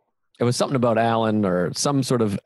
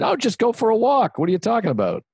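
high-pitched, exasperated brush-off; dismissive aunt; 10 semitones higher than usual. Retold or imitated. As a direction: imitate an aunt scoffing at a worry. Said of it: "aunt said".

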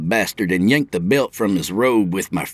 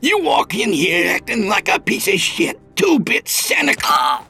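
furious shouting; 9 semitones higher than usual. A narrator's reading. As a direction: scream abuse at the attacker. Beaten man shouting defiance.

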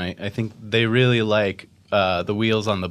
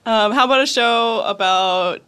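put-on voice; 13 semitones higher, 4 dB louder than usual. Pitching show in odd voice.

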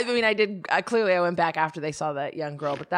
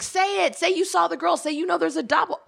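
much higher, urging the heroine on; pleading with the TV, pitched way up; mock yelling at the screen; 10 semitones higher than usual. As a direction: egg on a movie character as if she can hear you. Yelling at a Lifetime movie.

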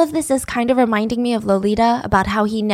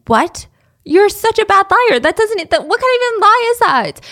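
higher, louder disbelieving scoff; mock incredulous; 9 semitones higher, 4 dB louder than usual. Police scoffing at Frank's lie, mock disbelief. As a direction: play the police not buying it. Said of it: "They're like".

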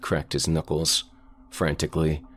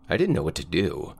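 ordinary delivery

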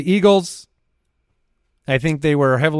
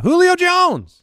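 high, drawn-out hype call; announcer call; 5 dB louder, slower than usual. Acted. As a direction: call the name like a stadium announcer. Announcing the player's return.

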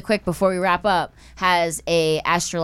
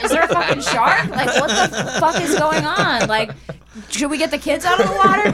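high-pitched worried beachgoers; hammed-up public panic, pitched up; mock alarm; 7 semitones higher than usual. Beachgoers reacting to shark warning, in mock alarm.

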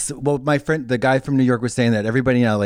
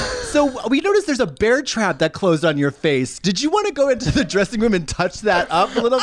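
careful, high-pitched customer-service voice; photographer; 8 semitones higher than usual. Acted. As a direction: gingerly suggest a touch-up in a high, polite voice. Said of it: "And the photographer's like".

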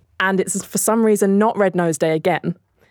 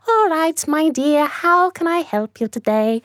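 higher, sing-song nurse voice; 8 semitones higher than usual. Acted. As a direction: put on a high, fussy-kind nurse. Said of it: "and the nurse says".

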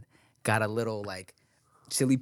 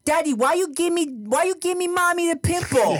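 put-on much higher, louder voice of a cross toddler; angry little girl; 4 dB louder than usual. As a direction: play an indignant little daughter scolding daddy. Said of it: "She's pissed".

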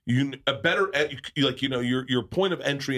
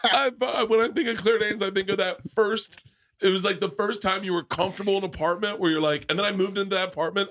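gushing; 10 semitones higher than usual. Real, not acted. Storyteller gushing over Claire Danes, laughing and excited. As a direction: gush about her with a laugh.

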